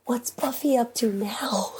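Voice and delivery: hushed, slow and breathy; subtle whisper. Acted. Adventurer peeking in, whispering to herself. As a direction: sneak the question out under your breath. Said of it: "I just try to be as really subtle".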